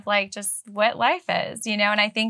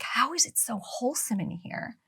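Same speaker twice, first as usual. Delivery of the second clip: whispering; 3 semitones higher, 8 dB quieter than usual. Retold or imitated. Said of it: "they'd be like".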